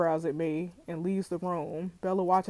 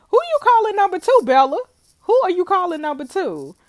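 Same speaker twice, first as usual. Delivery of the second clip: much higher, loud offended shout; indignant outrage; 11 semitones higher, 10 dB louder than usual. Acted. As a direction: take real offense, shout it. Offended at being called number two.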